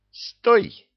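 sharp, high shout; loud commanding yell; barked command. A narrator's reading. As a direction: bark the order to halt. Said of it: "гаркнул воевода".

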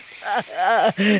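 bitter cackle. Acted. Cornelius laughs in his rant.

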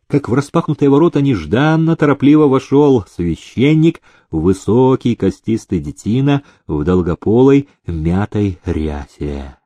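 plain reading voice

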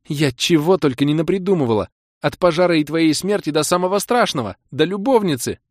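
high, loud rush of overblown worry; young woman on the edge; worked-up girlfriend. Acted. Kira venting her fears.

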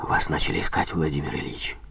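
hurried, hushed warning; anxious whisper. A narrator's reading. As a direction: blurt it out under breath. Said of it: "даже выпалил".

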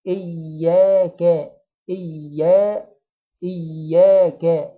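slow recitation of إياك with an open, stretched alif; drawn-out alif. Shown as a demonstration. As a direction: hold the alif in إياك two counts. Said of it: "بنفتح البق فوق شوية عشان في حركتين". (مد الألف في إياك حركتين بفم مفتوح)

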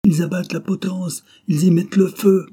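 high, loud furious cry; dying man raging at the burning gallows; choked with anger. A narrator's reading. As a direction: cry out in rage. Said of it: "d'une voix que la colère étouffait".

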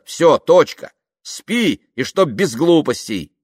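loud, high, mounting exasperation; fed-up telling-off. Acted. Man fed up recounts their agreement, exasperated telling-off. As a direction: lay down the law, building to sharp orders.

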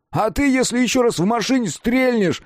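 high, loud angry yell; shouting. A narrator's reading. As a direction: bellow it furiously. Kolyan yells at shooter.

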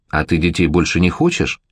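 ordinary delivery, nothing marked

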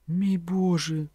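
high, drawn-out cry of dismay; alarmed exclamation. A narrator's reading. Lucja seeing the professor bitten by a dog, alarmed exclamation. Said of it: "вигукнула Люція".

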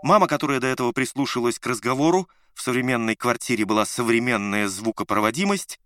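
plain reading voice

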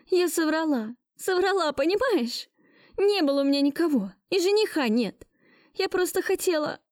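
high, hurried confession; frantic confession. Acted. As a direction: pour it out fast. Heroine confesses the lie.

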